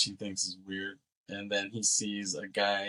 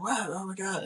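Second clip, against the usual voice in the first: mock freak-out; 10 semitones higher than usual. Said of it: "Richard is freaking out".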